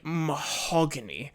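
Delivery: "mahogany" drawn out slowly, rising at the end; stress on mahogany. Shown as a demonstration. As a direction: stretch out "mahogany" like the famous line. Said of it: "putting the emphasis on mahogany".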